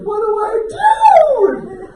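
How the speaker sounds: high, drawn-out helpless cry; desperate wail. Retold or imitated. Husband imitating wife caught in the current, desperate high wail. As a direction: wail it slowly, voice way up.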